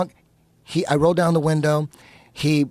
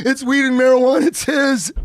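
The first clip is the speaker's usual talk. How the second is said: high, loud, hammed-up tearful snitching; imitating a wailing, panicked teenager; mock crying; 10 semitones higher, 4 dB louder than usual. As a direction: blurt out the blame as if bawling. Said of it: "I'm crying, throwing my friend under the bus".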